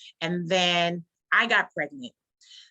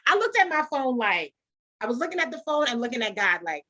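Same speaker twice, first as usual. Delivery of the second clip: ordinary talk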